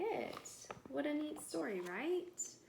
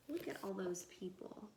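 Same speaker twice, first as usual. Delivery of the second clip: ordinary delivery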